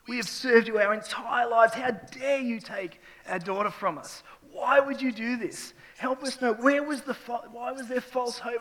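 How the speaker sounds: high, loud, raw protest hurled upward; anguished yelling at God. Retold or imitated. Grieving father yells his accusations at God, anguished. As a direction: pour out grief as rage at God. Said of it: "I'm throwing stuff at him".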